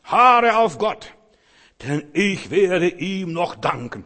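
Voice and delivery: high, slow, ringing preacher's shout; psalmist's cry. Acted. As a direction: cry it out to the heavens. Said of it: "So redet der Psalmist".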